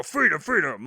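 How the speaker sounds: lightly mocked Southern accent; imitated Southern accent. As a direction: imitate someone's bad Southern put-on. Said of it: "you just get up in the Southern accent and you go".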